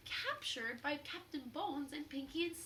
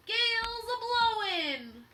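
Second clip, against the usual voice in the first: loud, high, slow call over the wind; Pinky yelling about the gale; pirate cry; 12 semitones higher, 13 dB louder, slower than usual. Acted. Pirate crying out in storm.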